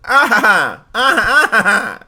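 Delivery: high, loud cartoon villain cackle; Mandark laugh. Streamer demoing the Mandark laugh for chat, high hammed-up cackle. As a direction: cackle like a gloating cartoon genius. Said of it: "Can anybody do the Mandark?".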